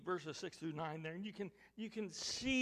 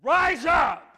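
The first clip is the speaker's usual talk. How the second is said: loud, high, brusque shout; brash shout; 9 semitones higher, 23 dB louder than usual. Shown as a demonstration. God rousing Joshua with a loud, brash shout. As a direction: shout the order. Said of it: "I believe God said".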